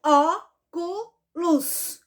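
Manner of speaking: "Óculos" broken into ó, cu, los; syllable by syllable. Shown as a demonstration. Teacher counting syllables for pupils, "Óculos" syllable by syllable. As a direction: say "Óculos" in three slow, separated beats. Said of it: "Vamos contar?".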